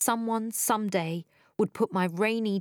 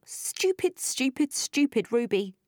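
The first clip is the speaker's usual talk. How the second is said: self-scolding; 5 semitones higher than usual. A narrator's reading. Scolding herself while fleeing.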